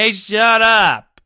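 high, loud, slow yell of shut up; fan-like shout. Shown as a demonstration. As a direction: bark it at rival fans, slow and big. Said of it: "You could just say".

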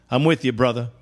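normal talking voice

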